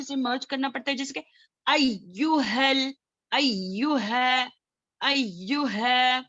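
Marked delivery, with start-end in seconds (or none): 1.7-6.2 अय्युहल read slowly with the doubled य pressed hard; pressed shadda recitation. Shown as a demonstration. Teacher models pressing the shadda for students, slow. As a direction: lean into the double य, slow and deliberate. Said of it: "press करना पड़ता है".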